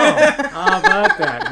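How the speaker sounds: high, slow gloating laugh. Real, not acted. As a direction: crow about it through laughter. Gloating after winning the guess.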